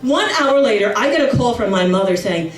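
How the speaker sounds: ordinary talk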